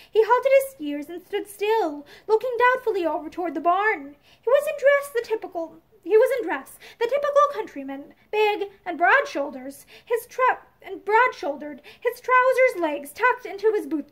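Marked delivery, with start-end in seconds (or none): none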